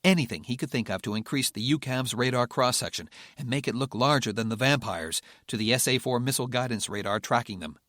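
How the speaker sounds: ordinary delivery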